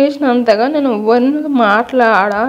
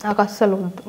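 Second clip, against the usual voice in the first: soft, withdrawn refusal; quiet rejection; 2 semitones lower, 8 dB quieter than usual. Real, not acted. Girlfriend tells boyfriend she doesn't want him, quietly.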